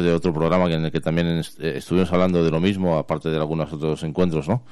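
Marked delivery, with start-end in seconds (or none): none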